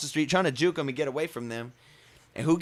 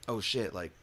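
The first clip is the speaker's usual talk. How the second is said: hushed to himself; 5 semitones lower, 13 dB quieter than usual. Retold or imitated. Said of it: "I go like".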